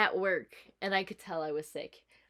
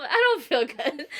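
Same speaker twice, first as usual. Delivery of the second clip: sick whimper; 6 semitones higher, 8 dB louder, slower than usual. Retold or imitated. Sick self voiced in story.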